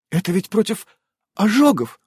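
small, high, hesitant voice; timid squeak. A narrator's reading. Evelina objects to commanding Irene, timid squeak. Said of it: "робко пискнула Эвелина".

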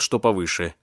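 ordinary delivery

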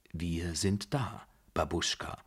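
ordinary delivery, nothing marked

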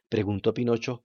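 plain reading voice

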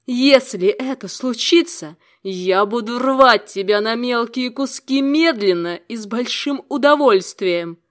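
loud, slow, menacing; menacing threat. Acted. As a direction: threaten him slowly and loudly. Demoness threatens Nibiros. (зловещая угроза)

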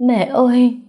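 high, loud wailing call to her mother; sobbing cry. Acted. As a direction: burst out crying like a child. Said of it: "Vân cứ khóc mãi không dứt".

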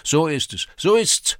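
very high, loud cheering cries; rousing shout, leaping up; shouted agreement. A narrator's reading. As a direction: yell assent like a whole crowd. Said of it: "schrien die Burschen wie aus einem Munde".

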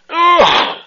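high, drawn-out hammed-up moan of pain; long mock moan of pain to the manager. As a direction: moan like your heart is giving out. Caller faking heart attack.

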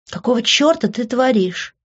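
a touch of outrage; indignant. A narrator's reading. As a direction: fling it at him in disbelief. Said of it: "возмутилась я".

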